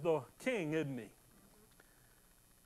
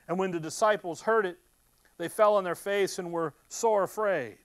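usual speaking voice

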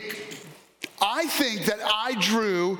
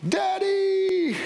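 crying out Daddy; 12 semitones higher than usual. Retold or imitated. Grown man wishing to call dad, high cry. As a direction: call out like a kid needing dad. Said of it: "if I could just cry out".

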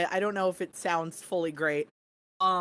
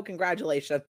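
ordinary delivery

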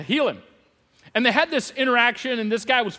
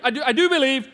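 loud, high, laughing "I do, I do"; eager cry; 6 semitones higher, 5 dB louder, faster than usual. Retold or imitated. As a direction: blurt it out eagerly, half laughing. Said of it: "The father instantly cried out".

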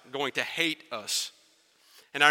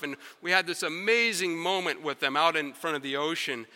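ordinary talk

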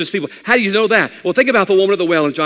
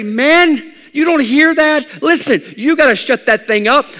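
mock confrontation; 7 semitones higher, 4 dB louder than usual. Confronting neighbor about dog.

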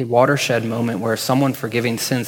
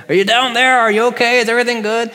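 high, louder calls down through the roof; worried friends calling down; 11 semitones higher, 5 dB louder, faster than usual. Retold or imitated. Friends on the roof call down to him, high worried shouts. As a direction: call down anxiously to the friend below.

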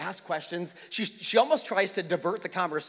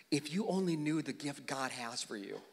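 softer and lower, gently; tender quiet Jesus; 6 semitones lower, 11 dB quieter than usual. Acted. Jesus to the woman at the well, soft and tender. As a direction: let Jesus say it softly to her.

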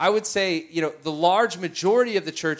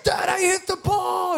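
excited shout; 14 semitones higher, slower than usual. Retold or imitated. Son bursting through the door to dad, excited high shout. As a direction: shout it like a kid who finally did it. Son coming home overjoyed.

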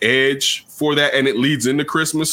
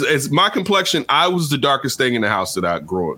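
usual speaking voice

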